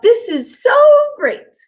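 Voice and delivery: delighted little rock; a hint of a pleased stone character; happy stone thinking. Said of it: "he thought".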